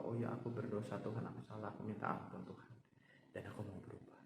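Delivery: soft, contrite prayer; humble confession. Retold or imitated. David confessing to God, soft and humble. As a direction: confess sins quietly.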